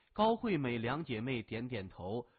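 ordinary delivery

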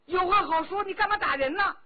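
loud, high indignant cry; girl protesting the blow; angry protest. A narrator's reading. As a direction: stand up to him. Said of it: "那个大姑娘也火了".

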